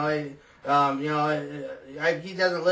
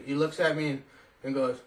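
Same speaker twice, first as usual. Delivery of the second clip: ordinary talk, nothing marked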